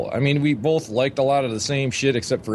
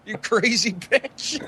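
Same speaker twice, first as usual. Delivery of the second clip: mock insult; 11 semitones higher, much slower than usual. Teasing friend.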